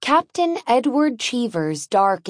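usual speaking voice

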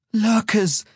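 high, strained cry of fear; frightened gasp. A narrator's reading. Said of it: "gasped, his voice strained with fear".

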